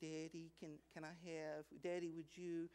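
soft, small pleading daughter's voice; soft, sweet voice of a daughter begging her dad; sweet little girl voice. Retold or imitated. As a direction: coax like a little girl asking Daddy. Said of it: "she got this sweet little voice".

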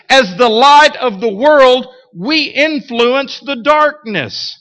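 rising, emphatic pulpit preaching. Acted. Preaching builds intensity.

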